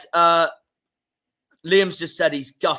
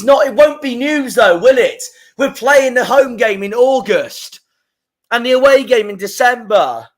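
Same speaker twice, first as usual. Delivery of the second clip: higher and louder, outraged; indignant complaint; 8 semitones higher, 6 dB louder than usual. Real, not acted. Fan complaining to his viewers about the fixtures, indignant. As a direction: stress the unfairness loudly.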